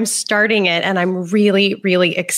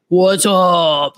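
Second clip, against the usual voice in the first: mock grown-man voice, slightly lower; manly voice; 3 semitones lower than usual. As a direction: imitate a kid who sounds like a grown man. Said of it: "this really manly voice".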